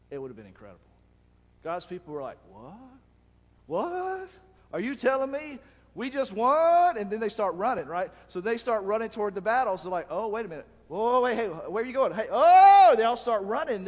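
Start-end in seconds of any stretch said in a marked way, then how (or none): 2.5-7.0 much higher, louder astonished what; can't believe we just won; stunned disbelief. Retold or imitated. Army stunned by the victory. 10.1-13.0 loud high calls chasing the crowd; excited rush. Retold or imitated. Soldiers rushing after fleeing enemy.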